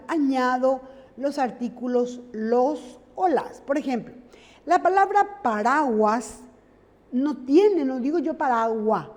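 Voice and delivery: usual speaking voice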